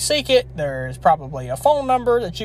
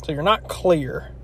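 ordinary delivery

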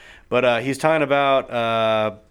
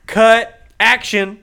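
louder, higher film-set command; mock director's call; 7 semitones higher, 7 dB louder, much slower than usual. Imitating film-set calls.

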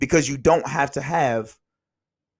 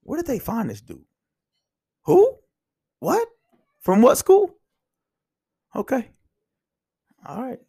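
mock disbelief; 11 semitones higher than usual. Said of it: "I shouldn't look down the bench and be like".

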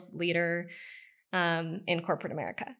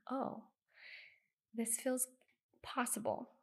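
soft realisation; 3 semitones higher, 11 dB quieter than usual. Real, not acted.

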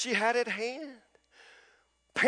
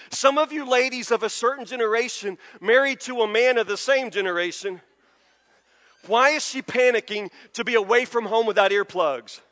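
ordinary delivery

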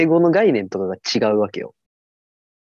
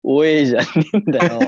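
mock indignant retort; 5 semitones higher, 4 dB louder, faster than usual. Mock scolding partner.

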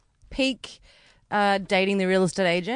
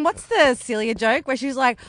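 ordinary talk, nothing marked

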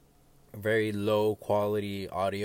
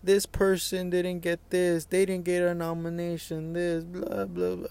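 mocking whiny complainers; 8 semitones higher than usual. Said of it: "They just complain and complain like".

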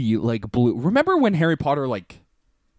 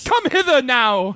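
put-on silly British accent; 6 semitones higher than usual. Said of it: "such a silly accent".

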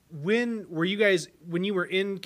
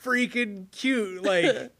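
high, loud, gushing over a cute couple; almost teary with delight; 9 semitones higher, 10 dB louder, slower than usual. Real, not acted. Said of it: "almost got tears in my eyes".

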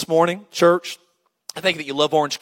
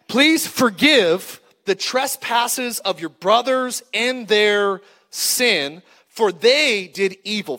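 drawn-out plea; much slower than usual. Acted. Reading brothers' plea dramatically.

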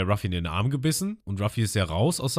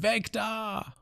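much higher, urgent cry; Lysop crying out; 14 semitones higher, 4 dB quieter than usual. Retold or imitated. Lysop tells Ruffy to move, high and urgent. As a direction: cry it out to get him moving. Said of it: "Und Lysop schreit".

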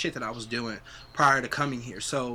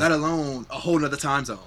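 usual speaking voice

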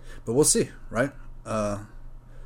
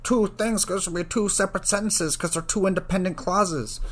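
high, whiny mock-pedant voice; hammed-up high mimicry of a fussy marker; mocking the TAs; 10 semitones higher, 3 dB louder than usual. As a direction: parody a nitpicking grader, drawing out the end. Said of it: "being like".

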